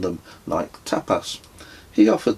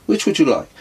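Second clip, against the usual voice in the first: higher and louder host's question; Claudio, higher and louder, offering tea to the stranger; character voice; 9 semitones higher, 7 dB louder, faster than usual. A narrator's reading. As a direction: ask it brightly like an eager host.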